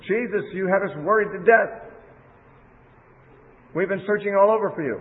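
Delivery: lightly voiced mother scolding her boy; a mild worried scold. Mother scolds twelve-year-old Jesus found in temple, mild worried scolding. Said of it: "and she scolded him".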